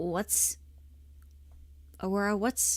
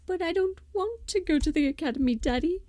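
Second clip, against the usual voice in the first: put-on high, loud pleading little girl; whiny child plea; 10 semitones higher, 4 dB louder than usual. Girl begging her father, high whiny plea. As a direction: beg her father not to send her away. Acted scene from old show.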